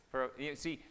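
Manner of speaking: ordinary talk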